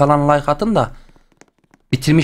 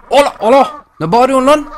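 startled yelp; 10 semitones higher, 4 dB louder than usual. Real, not acted. Penguins shouting at him.

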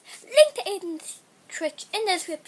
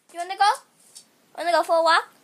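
calling to the dog; 2 semitones lower, 9 dB louder, much faster than usual. Real, not acted.

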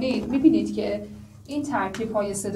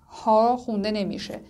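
ordinary delivery

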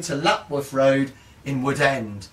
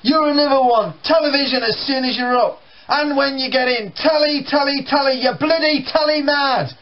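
higher, louder exasperated father; ranting dad; 11 semitones higher, 4 dB louder than usual. Retold or imitated. Dad ranting at son about television, loud and high. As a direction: rant about the telly.

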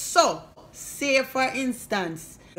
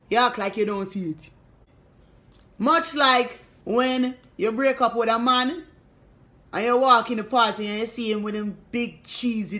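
ordinary delivery

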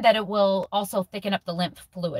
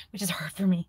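whispered aside; 3 semitones lower, 4 dB quieter than usual. Real, not acted.

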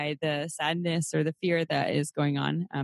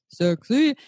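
sexy drawn out, higher and louder with a rise; sultry echo; 6 semitones higher, 6 dB louder than usual. Shown as a demonstration. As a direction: purr the word Sexy up and loud. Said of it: "she just sounds fucking sexy".

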